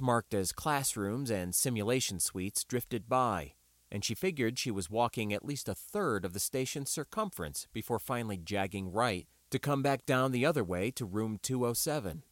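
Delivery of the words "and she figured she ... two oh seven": ordinary delivery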